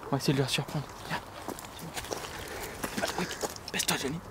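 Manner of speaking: hushed commentary while sneaking up on Boris. Acted. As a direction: creep in quietly, keep it down.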